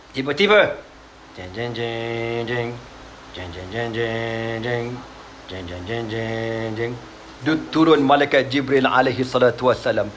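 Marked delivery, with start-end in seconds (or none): 1.4-7.0 deep, slow, soft suspense sting; dramatic jeng jeng. Acted. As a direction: hum a low ominous fanfare. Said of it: "dramatic sound effect". (bunyi suspens jeng jeng yang dalam)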